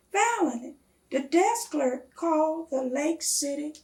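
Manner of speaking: plain reading voice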